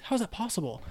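high, incredulous question; a missionary baffled by slang, high-pitched; mock disbelief. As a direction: ask in total shock. Retold disbelief.

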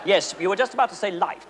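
ordinary talk, nothing marked

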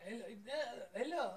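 high, thin mock Russian hellos; mock accent. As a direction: hammier foreign hello each time. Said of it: "it's getting worse".

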